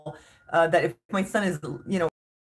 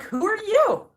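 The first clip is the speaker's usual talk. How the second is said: much higher, incredulous retort; indignant who-are-you; 13 semitones higher, slower than usual. Retold or imitated. To the stranger lecturing her, high incredulous retort. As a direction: fire it back in disbelief. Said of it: "And I'm like".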